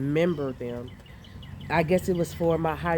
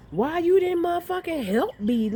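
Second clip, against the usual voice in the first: shrill-free mock outrage at husband; indignant yell; 12 semitones higher than usual. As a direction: yell at him for not helping. Yelling at husband after fight.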